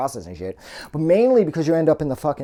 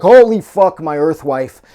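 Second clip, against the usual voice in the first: retold panicked yell, much louder and higher; freaking out; 4 semitones higher, 13 dB louder than usual. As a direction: shout it like he just saw something horrible. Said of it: "he freaks out".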